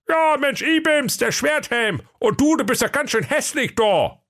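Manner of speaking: high-pitched grumbling sleeper-car conductor; comic high fool griping; high sulky conductor. Acted. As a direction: whine about the job in a high silly voice. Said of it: "rief der Schwerthelm".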